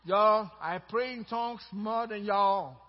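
badly faked Texas accent. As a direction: try a Texas drawl and miss. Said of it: "My Texas accent is not working for me".

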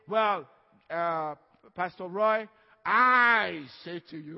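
slow, loud, drawn-out "Pastor Roy, I say to you" as a lightly voiced show-off; mock casual pastor. Shown as a demonstration. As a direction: lightly parody a pompous pastor. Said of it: "I don't say".

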